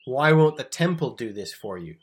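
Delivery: loud, indignant question; mad. Acted. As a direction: demand it as an exasperated Jesus. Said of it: "Jesus is mad at the temple".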